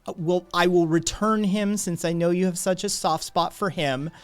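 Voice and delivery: loud, high-pitched, insistent protest; exasperated rant. Acted. Passerby promising partner to return the robot, exasperated. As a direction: rattle on loudly, making a show of it.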